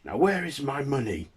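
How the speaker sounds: high, forceful threat; aggressive debt collector. Acted. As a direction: demand it loudly like a bullying collector. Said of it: "an aggressive debt collector comes and says".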